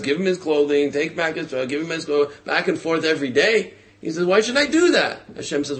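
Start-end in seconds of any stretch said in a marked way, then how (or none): 4.2-5.2 P=A; indignant protest. Retold or imitated. Person protesting to Hashem.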